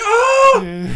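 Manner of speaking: high, loud pained cry of mock agony; Coyote Peter yelp. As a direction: yelp like you've just been stung, hammed-up. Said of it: "like Coyote Peter".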